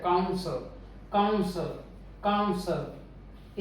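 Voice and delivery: Council with strong काउं, weak सल; stress on first syllable. Shown as a demonstration. Teacher drills council, stress on first syllable. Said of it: "पहला syllable stressed है".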